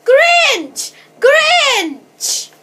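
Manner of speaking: high, loud drawn-out cry; shouting alarm. A narrator's reading. Girl pretending a Grinch is after reindeer, shouting. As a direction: shout the alarm at full voice, falling away. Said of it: "shouted at the top of her voice".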